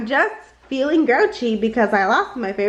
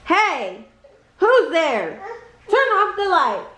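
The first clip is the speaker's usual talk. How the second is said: grouchy; 6 semitones higher, slower than usual. Acted. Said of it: "a grouchy voice echoed".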